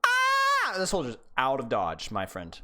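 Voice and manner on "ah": high, loud startled yelp; panicked yelp. Retold or imitated. Coach voicing the enemy soldier's startled high yelp. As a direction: squeal like a soldier caught off guard. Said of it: "that soldier's like".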